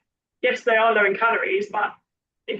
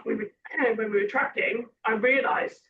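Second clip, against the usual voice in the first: normal talking voice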